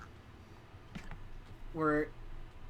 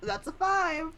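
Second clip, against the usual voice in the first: high squeaky dismay; 11 semitones higher than usual. Acted. GM dramatic reveal of roll.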